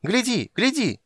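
very high excited squeal; thrilled little creature, way up high; delighted squeaking. A narrator's reading. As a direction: squeak with glee. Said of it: "в восторге пищала Бупу".

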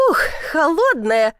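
startled exclamation. A narrator's reading. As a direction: exclaim at the chill. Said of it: "раздался возглас". (возглас от холода)

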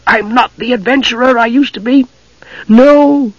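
ordinary delivery, nothing marked

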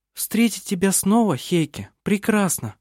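lightly voiced young woman, clear and deep; a hint of a young woman, gently. A narrator's reading. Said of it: "произнесла она своим чистым, глубоким голосом".

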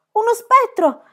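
high, loud terrified shout; wild scream. A narrator's reading. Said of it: "gridò Tommy selvaggiamente". (grido selvaggio di terrore)

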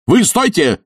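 high-pitched angry shout; shouting them down. Acted. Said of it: "перекричал Филипп Филиппович".

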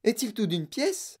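slow, high-pitched worried question; anxious cry. Acted. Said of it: "cria madame Tivy".

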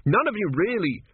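high-pitched, aggrieved insistence; affronted victim pleading his case; indignant protest. A narrator's reading. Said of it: "he demanded".

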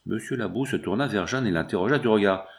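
ordinary delivery

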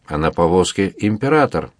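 plain reading voice

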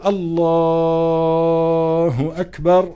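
الله stretched very long, then أكبر after a pause; drawn-out Allah. Shown as a demonstration. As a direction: hold the word الله long while forming the intention. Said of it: "بتمد لفظ الجلالة الله".

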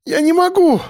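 very high-pitched woman's cry; desperate cry. A narrator's reading. Liza stumbles while fleeing, desperate high cry. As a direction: cry out that you can't go on. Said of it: "кричала Лиза".

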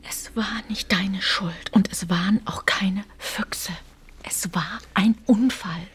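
soothing insistent whisper; whispered reassurance. Acted. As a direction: comfort in a hush. Hushed argument at night.

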